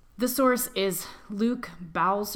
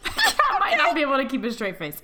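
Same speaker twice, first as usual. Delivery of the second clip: fast, loud, laughing; 6 semitones higher, 5 dB louder, faster than usual. Real, not acted. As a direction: hold back giggles.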